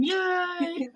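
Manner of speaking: high, drawn-out cheer; cheering yell. Pretend. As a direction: stretch out a high celebratory yay. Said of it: "Everyone yell, get their last words in".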